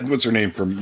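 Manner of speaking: normal talking voice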